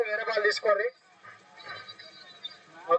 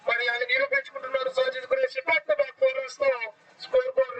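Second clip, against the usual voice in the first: lively, raised play-by-play call; excited cricket commentary; 6 semitones higher than usual. Real, not acted. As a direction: call the chase with rising excitement.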